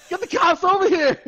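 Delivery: frantic mock caller. Calling 911 about the clown, frantic shout. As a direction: yell at the dispatcher in mock panic.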